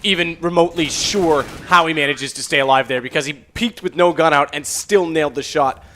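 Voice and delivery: loud, amazed esports commentary; emphatic live match call, pitch lifted; excited play-by-play. Real, not acted. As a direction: call a narrow survival with rising disbelief. Live play-by-play, narrow survival.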